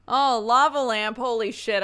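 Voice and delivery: a sudden loud, high burst of delight; excited. Real, not acted. Thrilled by a lava lamp, loud excited outburst. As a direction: blurt it out, thrilled at the sight.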